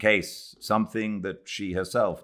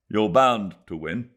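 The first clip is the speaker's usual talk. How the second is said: usual speaking voice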